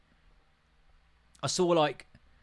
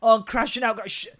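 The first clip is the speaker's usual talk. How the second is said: mocking quote; 7 semitones higher, 5 dB louder than usual. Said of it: "They say it all the fucking time".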